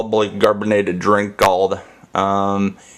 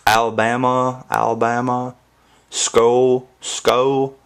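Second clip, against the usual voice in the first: put-on Alabama accent; 4 semitones higher, slower than usual. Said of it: "I love how they talk".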